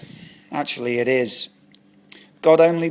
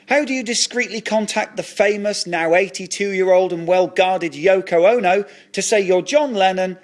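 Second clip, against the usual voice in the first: ordinary delivery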